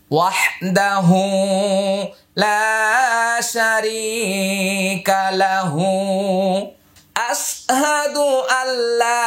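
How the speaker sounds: slow, drawn-out Quranic recitation. Shown as a demonstration. Teacher modelling the phrase for learners to memorise, slow chant. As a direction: draw each vowel out like a reciter. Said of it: "এভাবে মুখস্থ করে নিন।".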